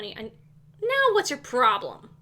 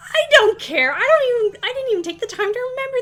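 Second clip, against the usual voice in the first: loud, high, fed-up outburst; exasperated shout of I don't care; frustrated yell; 9 semitones higher, 11 dB louder, faster than usual. Real, not acted. Yelling at misbehaving Sims children.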